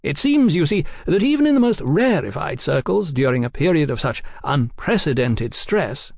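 lightly voiced, genial gentleman; cordial gentleman journalist. A narrator's reading. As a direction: explain yourself with airy cordiality. Oliphant explains at length.

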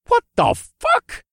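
high, slow, disbelieving exclamation; stunned by the sight; shocked. Acted. Shocked by dragon's lightning.

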